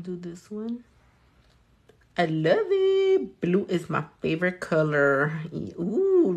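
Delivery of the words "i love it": delighted. Real, not acted. Admiring her finished nail.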